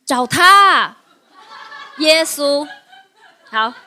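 loud, laughing, punching the answer; amused loud retort; laughing exclamation. Real, not acted. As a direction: laugh it out like a comeback.

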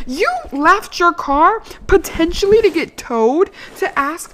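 loud, high disbelieving rant; incredulous. Real, not acted. Telling listeners about the driver's request, loud indignant rant. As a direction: let the outrage climb.

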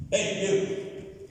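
high-pitched mock summons; playful acted shout for attention; calling out. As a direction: hail someone across the room. King summons a servant, acted.

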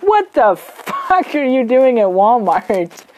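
slow, stunned mom voice; a light take on an outraged mom. Retold or imitated. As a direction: play the mom, slowed right down in disbelief. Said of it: "My mom's like".

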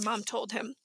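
ordinary delivery, nothing marked

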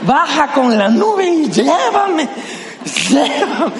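mock desperate plea. Begging Jesus to take him.

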